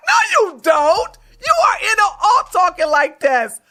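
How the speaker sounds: high, loud, laughing through the words; incredulous laughing shout; laughing disbelief. Real, not acted. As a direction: crack up while calling him out. Mocking his claim, laughing.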